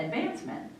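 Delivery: ordinary delivery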